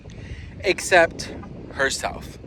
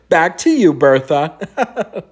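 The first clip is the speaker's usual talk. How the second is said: louder, mock newsroom hand-off; news anchor sign-off; 5 dB louder, slower than usual. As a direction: toss it back to the studio. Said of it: "I sounded like a news anchor".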